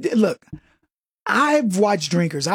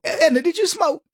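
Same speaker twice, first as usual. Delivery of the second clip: much higher, chirpy asking; bright mock question; 10 semitones higher than usual. Kevin asks the smoker lady about smoking, up high. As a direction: pitch up like a curious coworker. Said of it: "I think".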